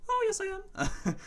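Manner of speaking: very high, slow whiny voice; babyish squeak. Pretend. Mocking his own fear, a high slow babyish whine. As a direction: play the scared baby. Answering himself in baby voice.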